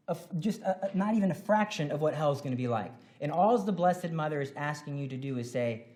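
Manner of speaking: ordinary talk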